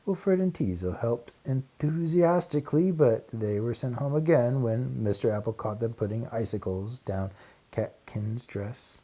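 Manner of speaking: plain reading voice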